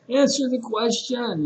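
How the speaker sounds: acted exasperation at a silent student; frustrated teacher. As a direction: mimic a teacher losing patience. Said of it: "this frustrated teacher".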